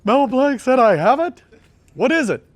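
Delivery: high, loud, astonished squeal; stunned disbelief. Retold or imitated. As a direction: blurt it out like you can't believe your ears. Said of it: "I'm like".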